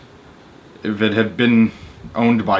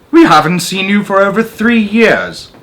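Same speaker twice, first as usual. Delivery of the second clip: higher, louder voice of a fawning doorman; hearty welcome; 11 semitones higher, 8 dB louder than usual. Acted. Desk man to Henry after three years away, fawning welcome. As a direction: make him feel missed and important.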